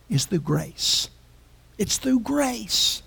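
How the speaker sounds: normal talking voice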